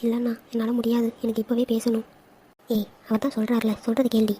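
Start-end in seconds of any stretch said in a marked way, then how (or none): none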